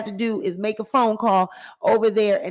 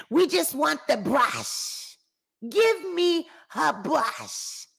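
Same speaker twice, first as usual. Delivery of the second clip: slow, drawn-out voodoo demand; Shaq's mother; 5 semitones higher, slower than usual. Acted. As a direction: stretch each order out, pitch climbing higher. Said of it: "I told y'all the mom be like".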